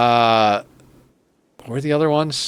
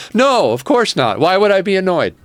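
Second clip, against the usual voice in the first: sarcastic; 7 semitones higher, 4 dB louder than usual. Pretend. Sarcastic denial after being called annoyed.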